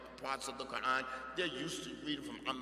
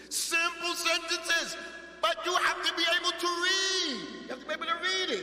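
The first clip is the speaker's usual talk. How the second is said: emphatic exhortation; 7 dB louder than usual. Real, not acted. Teacher urging students to read simple sentences, emphatic and loud. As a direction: project it high and hard to the whole class.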